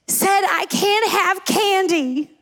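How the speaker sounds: high, slow tearful complaint; mock crying child. Dad imitating his emotional son tattling to mom, mock tearful. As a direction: wail it like an upset little kid. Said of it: "he is crying, and he says".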